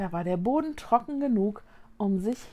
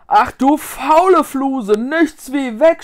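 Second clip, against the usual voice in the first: loud, higher, drawn-out cry of alarm; panicked scream; 4 semitones higher, 12 dB louder, slower than usual. A narrator's reading. Ghost yells to his companion to flee, screaming. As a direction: yell to flee at once. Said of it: "schrie Glotzer".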